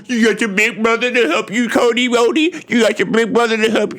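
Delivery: high, sing-song playground mockery; mock baby-talk jeering; mocking taunt. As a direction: taunt him like a little kid. Jericho mocks Cody, baby-talk taunt.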